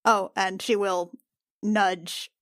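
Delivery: ordinary delivery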